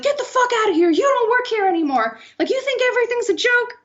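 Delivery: high, strained mock yell; irate rant with the voice pushed way up; imitated screaming. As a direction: act out a furious boss firing someone. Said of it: "he's, like, screaming at her".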